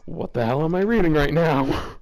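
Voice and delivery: real baffled outcry at the text; incredulous. Real, not acted. Host reading fanfic, shocked by the plot. Reacting to absurd fanfic line.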